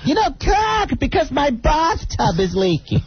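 high mock Boston mother-in-law voice; Boston accent. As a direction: play the old lady with a Boston accent. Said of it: "with the Boston accents".

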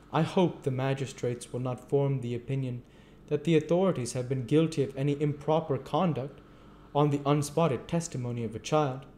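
lightly voiced anxious board man; nervous official. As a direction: defend himself uneasily. Limpkins defending himself.

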